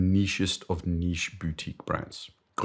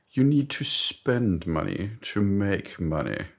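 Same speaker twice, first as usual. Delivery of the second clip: slow mock-British maxim; British boss; 2 semitones higher, slower than usual. British boss persuading doubtful colleague, slow pompous mock-British. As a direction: deliver it deliberately in a British accent. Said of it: "I d-, don't know why he's British".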